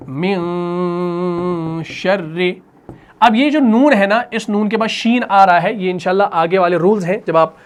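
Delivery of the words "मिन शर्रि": मिन शर्रि drawn out very slowly, with the न hidden as a soft nasal hum before श; hidden noon. Shown as a demonstration. Tajweed teacher demonstrating ikhfa to students, nun softly hidden. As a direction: hide the nun lightly in मिन and glide into शर्रि. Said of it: "जैसे हम कहते हैं".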